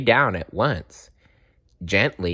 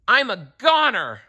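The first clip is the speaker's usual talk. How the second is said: high loud frightened cry; gasp; 13 semitones higher, 4 dB louder than usual. A narrator's reading. Toad crying out in fright at the lorry, high and loud. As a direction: panic as the lorry comes. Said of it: "gasped Toad".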